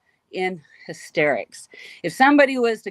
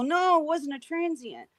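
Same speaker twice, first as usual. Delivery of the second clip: exasperated denial; 9 semitones higher than usual. Retold or imitated. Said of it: "I'm getting mad".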